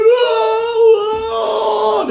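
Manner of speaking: very high, shrill-sounding cry of horror; imitating a horrified Jamaican crying out; mock horrified wail. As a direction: shriek as if scandalised. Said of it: "they be like".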